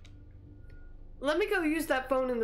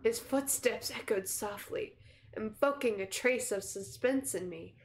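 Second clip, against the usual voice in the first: slow, low-key suspense narration; measured, subdued storyteller; story narration; 3 semitones lower, 4 dB quieter than usual. A narrator's reading. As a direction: narrate slowly and quietly, with hushed suspense. Reads game's narration.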